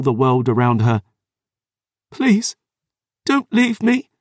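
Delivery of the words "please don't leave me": high, pleading sob; begging. Acted. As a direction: beg through tears. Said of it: "she begged".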